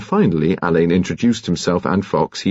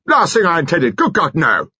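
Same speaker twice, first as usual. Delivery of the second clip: shouted; 10 semitones higher than usual. A narrator's reading. Seiss shouting his denial at the detective. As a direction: explode in shocked indignation. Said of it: "Seiss shouted".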